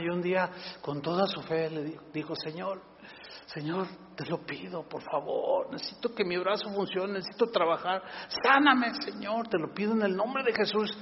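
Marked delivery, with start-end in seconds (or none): 2.3-10.9 súplica mansa, casi en voz baja; súplica suave. Retold or imitated. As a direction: rogando a Dios, bajito y con fe. Hombre tullido suplicando con fe. (el hombre tullido ruega a Dios en voz baja)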